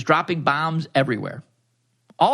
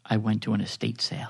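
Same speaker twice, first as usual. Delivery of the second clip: sultry storyteller voice; 6 semitones lower, 6 dB quieter than usual. Acted. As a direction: read it like a bedtime story for grown-ups. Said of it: "our Dipsy voice model here with us today".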